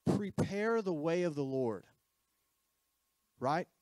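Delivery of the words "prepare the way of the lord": slow, drawn-out proclamation; crying out. Acted. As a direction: cry it out like a herald in the wilderness. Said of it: "We're crying out".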